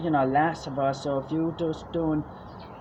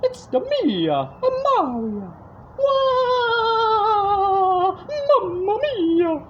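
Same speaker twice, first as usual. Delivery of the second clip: high, loud, slow cartoon plumber; sing-song Italian video game hero; Mario voice; 5 dB louder, much slower than usual. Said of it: "something like Mario".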